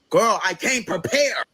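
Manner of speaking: slow, high, strained yell; fed up, throwing your hands up; exasperated shout. Retold or imitated. Asked about expensive mausoleum.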